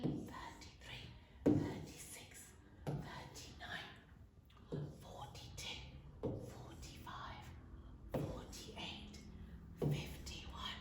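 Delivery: slow, very soft whisper; hushed count as a prompt. Shown as a demonstration. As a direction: whisper each number and leave a gap. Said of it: "I'll whisper it".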